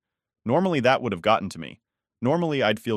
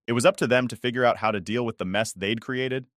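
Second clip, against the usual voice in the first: usual speaking voice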